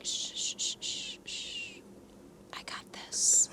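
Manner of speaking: mock shushing, then a whisper; hushing whisper. Stephen hushes the nobles to take charge, hushed mock whisper. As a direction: shush them softly and whisper.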